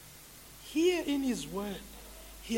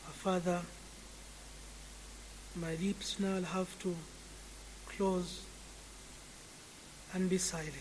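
subdued, lower-pitched praying with long pauses; solemn prayer; 4 semitones lower, 7 dB quieter than usual. Real, not acted. Preacher to God after the sermon, hushed closing prayer.

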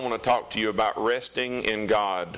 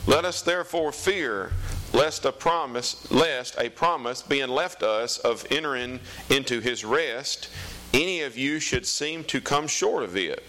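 raised, slow solemn Bible reading; scripture reading; 5 semitones higher than usual. Acted. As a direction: read it out like the pulpit. Reads scripture aloud.

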